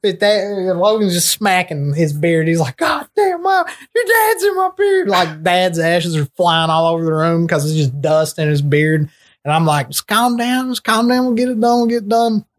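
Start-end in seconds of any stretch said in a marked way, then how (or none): 2.8-5.1 high, panicked cry with ashes in his beard; panicked yelp. Retold or imitated. Friend panicking as ashes cover his beard, high panicked yelp. 9.9-12.4 put-on high, soothing drunk pleading; mock-soothe him, high and sing-song; drunk calming. Drunk narrator calming his panicking friend, high sing-song soothing.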